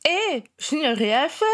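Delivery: slow protest through a mouthful; mouth full. A narrator's reading. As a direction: talk around a bite of food. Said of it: "protesta Ron la bouche pleine".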